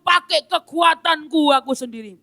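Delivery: stubborn anger. Acted. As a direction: vow to survive without God. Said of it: "Om Kristen lanjut dengan marah-marahnya".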